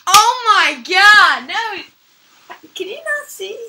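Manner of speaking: loud slow shocked cry. Real, not acted. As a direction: gasp it out in disbelief. Shocked reaction.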